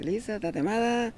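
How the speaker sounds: pregón agudo y alargado; pregón callejero. Shown as a demonstration. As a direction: vocea agudo, alargando la última vocal como vendedor ambulante. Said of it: "Se, se, se grita". (pregón de Tuxpan, agudo y alargado)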